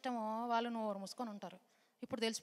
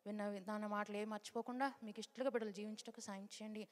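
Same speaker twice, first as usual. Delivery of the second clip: hushed, reverent prayer voice, much softer; soft, earnest closing prayer; 3 semitones lower, 7 dB quieter than usual. Real, not acted.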